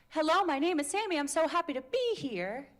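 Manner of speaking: bouncy sing-song. Shown as a demonstration. Coach shows a sing-song intro to the class. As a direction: chirp it up and down like a happy kid. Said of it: "Or is it".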